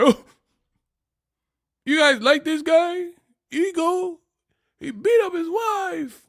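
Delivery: high squeaky mocking voice; whiny mock-outraged critic; mocking hater voice. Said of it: "quoting haters".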